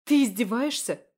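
put-on girl screaming insults at her captor; furious insults. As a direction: hurl every insult at him. She hurls insults at him.

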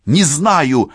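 loud, high, annoyed retort; irritated. A narrator's reading. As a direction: answer sharply, fed up with the question. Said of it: "раздражённо ответила Францискович".

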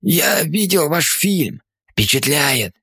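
slow, breathless words; out of breath. A narrator's reading. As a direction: catch breath between phrases. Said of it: "сказал через отдышку".

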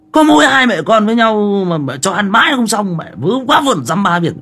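loud, very high-pitched scolding; retold yelling at wife. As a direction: re-enact snapping loudly at your wife. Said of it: "Mình quay sang quát vợ".

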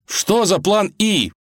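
high, drawn-out bellow; exasperated, panicked shouted question; yelling. Acted. Prince hangs over the abyss questioning the wolf, yelling. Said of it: "заорал царевич".

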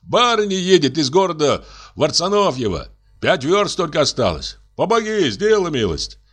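high, imploring plea through a door; pleading coachman. Acted. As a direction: beg to be let in out of the cold.

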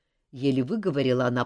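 ordinary delivery, nothing marked

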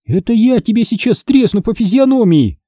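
high-pitched mild threat; threatening. A narrator's reading. Hannah to the boy mocking her face, threatening. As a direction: warn him off in a high voice. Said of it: "пригрозила ему Ханна".

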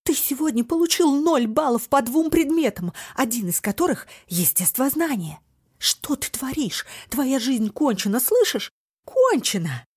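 raging, shrill yelling; berserk rage. A narrator's reading. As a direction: shout at him, losing control. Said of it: "в бешенстве закричала мама".

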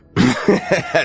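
high dismissive laugh; haughty laugh. Acted. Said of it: "засмеялся". (надменный смех)

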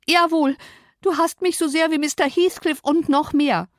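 high, hurt accusation; wailing young Cathy. Acted. Cathy accuses her cousin of hatred, wailing. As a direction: accuse them in a high, upset voice.

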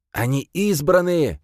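high, drawn-out exclamation; crying out. A narrator's reading. Priest announces the chosen ones to warriors, crying out high. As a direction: cry it out like an excited priest. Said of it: "вскрикнул жрец".

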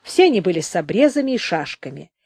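ordinary delivery, nothing marked

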